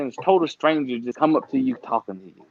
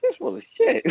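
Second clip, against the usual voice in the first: high mock disbelief. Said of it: "And you're like".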